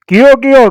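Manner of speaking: high, loud cry of a wounded partridge; bird shriek. A narrator's reading. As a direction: screech it like a hurt bird. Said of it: "એવી કિકિયારી કરતું".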